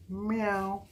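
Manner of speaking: high, drawn-out cat meow; playful meow. Pretend. Imitates cat as a clue.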